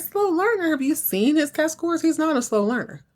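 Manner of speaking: high-pitched, offended protest; a touch of a mother's outrage; indignant mom. Retold or imitated. Karen defending her son to the principal.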